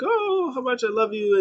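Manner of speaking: high, swooning sweetheart imitation; mock lovey-dovey. Puppets playing lovers before a kiss, high mock-romantic gushing. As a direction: coo it in a put-on high voice.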